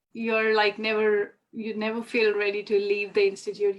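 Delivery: ordinary talk, nothing marked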